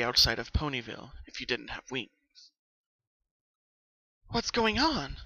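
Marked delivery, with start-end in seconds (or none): 4.3-5.2 high, bewildered question; stunned at the chasm; staring in shock. Acted. Pinkie staring at the giant chasm, high and bewildered. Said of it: "she asked as she stared".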